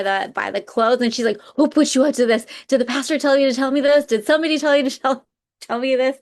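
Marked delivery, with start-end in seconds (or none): 1.6-6.2 retold demand, higher and louder, rushing; urgent confrontation. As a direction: act out confronting a friend, raised pitch. Stunned woman confronts her friend.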